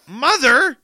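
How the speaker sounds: high, loud pleading; mock plea. Pleading with mom.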